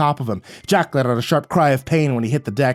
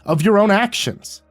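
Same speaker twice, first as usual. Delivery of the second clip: raised voice; 6 semitones higher than usual. A narrator's reading. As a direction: shout him down, voice raised. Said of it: "I raised my voice and cut him off".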